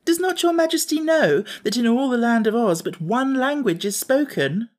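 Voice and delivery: high-pitched, amused explanation; merry. A narrator's reading. Jellia telling the Scarecrow about Oz's language, merrily. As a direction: explain the obvious with great amusement. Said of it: "now laughing merrily".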